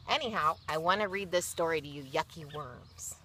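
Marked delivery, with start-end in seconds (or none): none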